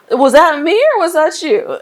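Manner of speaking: high, loud retold disbelief; incredulous questioning. Startled reply to hearing the Lord.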